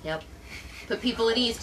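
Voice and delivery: ordinary talk, nothing marked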